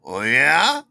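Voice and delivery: loud, sharply rising query; retold sceptical question. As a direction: question it loudly, pitch climbing to the end. Boss questions him in retold exchange.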